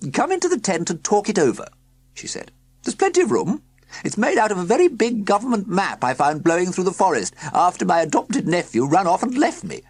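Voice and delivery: high, loud cheery woman's voice; a bright chatty countrywoman; woman in overalls. A narrator's reading. Woman at the tent announcing the car problem, high and loud.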